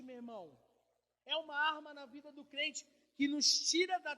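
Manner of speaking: loud, climbing sermon; fiery preaching. Acted. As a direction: proclaim it with force. Preaching at full force.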